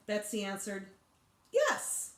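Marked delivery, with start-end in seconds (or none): 1.5-2.1 put-on much higher, louder kid's yes; blunt little kid. A narrator's reading. Betsy bluntly telling Sheila yes, high and loud. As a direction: pop it out bluntly.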